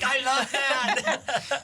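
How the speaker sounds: high, very slow, laughing through it; delighted and cracking up. Retold or imitated. Comic loving his new name, laughing and delighted. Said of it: "He's like".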